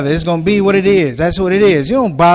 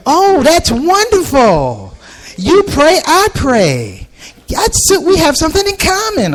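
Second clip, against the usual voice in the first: much higher, slow delighted lady voice; gushing lady; 10 semitones higher, slower than usual. Retold or imitated. Lady thrilled they both pray, high gushing voice. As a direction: gush over finding common ground.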